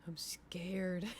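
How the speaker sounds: hushed, airy aside; whisper. Real, not acted. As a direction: whisper it under your breath. Whispered aside before a raid.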